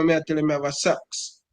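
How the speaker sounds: ordinary delivery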